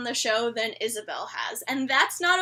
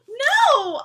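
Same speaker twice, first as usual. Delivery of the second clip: loud, higher, emphatic refusal; outraged no; 8 semitones higher, 6 dB louder than usual. Retold or imitated. Viewer rejecting a scene in the show, outraged protest. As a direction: cry out in protest.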